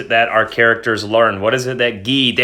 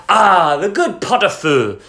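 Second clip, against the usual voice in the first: higher, delighted voice for the husband; enchanted husband; 8 semitones higher than usual. A narrator's reading. Husband delighting in plain soup at dinner, enchanted exclamation. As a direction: savour the soup with a hint of French. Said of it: "declared with an enchanted air".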